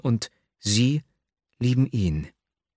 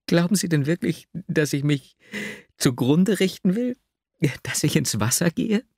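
put-on higher, aggrieved young woman's lament; Nastjenka's voice; 8 semitones higher than usual. A narrator's reading. Young woman complains of her cruel lover, higher and hurt. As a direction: plead bitterly in a higher voice.

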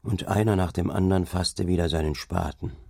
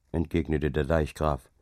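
ordinary delivery

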